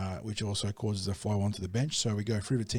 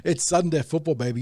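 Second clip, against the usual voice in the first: excited; 7 semitones higher, 9 dB louder than usual. Real, not acted. Excited sports banter.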